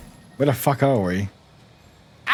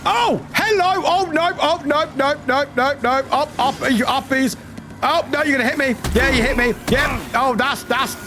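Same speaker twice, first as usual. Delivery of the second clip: high-pitched gamer panic; real flustered alarm, voice held high; 7 semitones higher than usual. Real, not acted. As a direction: babble in alarm as the attack comes, easing slightly. Enemy rushes at player.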